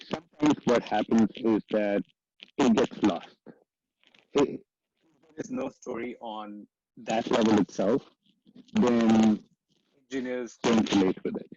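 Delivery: normal talking voice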